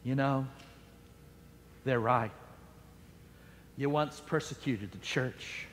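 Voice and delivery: the doubting voice in Paul's head, whispered low; inner whisper. Acted. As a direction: murmur it like a voice in his head. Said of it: "started whispering and saying to him".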